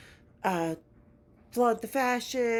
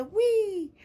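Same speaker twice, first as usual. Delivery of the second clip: whee; 12 semitones higher, 6 dB louder than usual. Real, not acted. Relief at breathing again.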